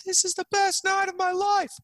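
high-pitched, loud tearful wail; hammed-up weeping with happiness; mock crying. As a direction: wail it like an overjoyed, sobbing drunk friend. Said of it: "he's, like, crying".

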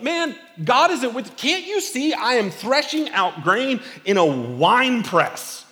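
high, loud, exasperated protest; mock-outraged complaint rising to a squeak; exasperated Gideon. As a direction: Gideon pleading in frustration, voice cracking up on the last line. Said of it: "Gideon's like".

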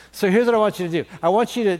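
normal talking voice

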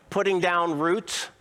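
ordinary talk, nothing marked

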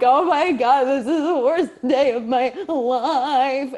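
playing up her own overblown misery; mock despair. Retelling her worst day, mock tragic lament. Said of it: "And I was like".

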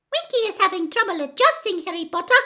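high, squeaky elf confiding; confidential squeak. Acted. Dobby confides in Harry about Winky, squeaking. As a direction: squeak it as an aside to a friend. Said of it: "squeaked Dobby confidentially".

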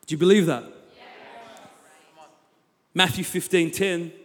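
normal talking voice

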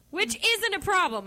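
high sarcastic aside. Acted. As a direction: read it dripping with irony. Ironic reading.

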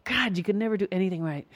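acted exasperated self-reproach; scolding inner voice. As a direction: chide yourself in disgust. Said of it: "here comes the inner voice".

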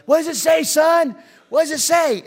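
high, loud calling out from afar; yelling dad. Retold or imitated. Dad yelling to son a hundred yards away, loud call. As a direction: shout up the trail to your son. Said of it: "He yells".

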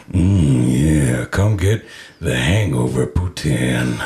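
low, slow seductive purr; sexy voice. Acted. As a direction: seduce the listener with every ingredient. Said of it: "read these ingredients off in a sexy voice".

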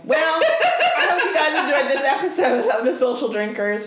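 sign-off through laughter; laughing. Real, not acted. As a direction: try to close the show while laughing. Sign-off while breaking into laughter.

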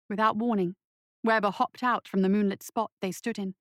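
ordinary delivery, nothing marked

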